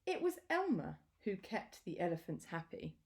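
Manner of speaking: ordinary delivery, nothing marked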